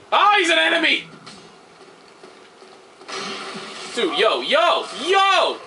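alarmed. Real, not acted. Gamer surprised by a monster, panicking. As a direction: yelp as it rushes you.

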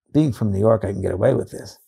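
usual speaking voice

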